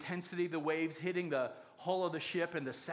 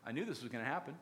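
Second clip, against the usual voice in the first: lower, softer imitated resignation; unsurprised; 5 semitones lower, 5 dB quieter, much faster than usual. Jonah caught when the lot falls, acted unsurprised. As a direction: say it unsurprised, low and soft. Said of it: "my guess is he wasn't surprised".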